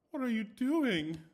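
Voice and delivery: high, loud, slow demand; shocked and upset wife confronting the maid. A narrator's reading. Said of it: "and asked".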